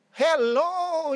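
high, loud, drawn-out hello; retold eager call. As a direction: sing out a greeting to whoever is at the door. Mother calling out at the door.

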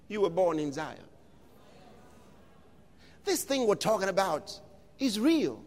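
ordinary delivery

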